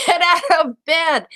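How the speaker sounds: loud, high, laughing get out of bed; exasperated teacher yell; mock scolding. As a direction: shout it half laughing. Teacher reenacts scolding a student.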